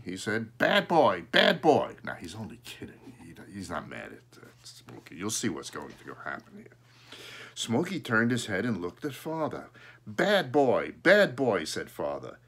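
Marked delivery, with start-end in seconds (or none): 0.6-2.0 louder, punchy playful telling-off; teasing reprimand with a punch; mock scolding. Father mock-scolds the pet crow. 10.1-11.6 loud, emphatic playful reprimand; punchy pretend telling-off; mock scolding. Father teaches crow the phrase.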